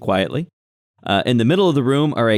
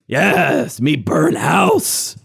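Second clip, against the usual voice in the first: higher, drawn-out goblin boast; gleeful goblin; 4 semitones higher, much slower than usual. Acted. Goblin butting in to boast of arson, gleeful. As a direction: relish it slowly as a dim firebug goblin.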